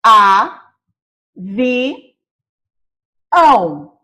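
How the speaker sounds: "A, vi, ão" in slow, separate beats; slow classroom syllable demo; syllables split apart. Shown as a demonstration. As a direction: break "avião" into its syllables. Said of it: "Vamos separar avião".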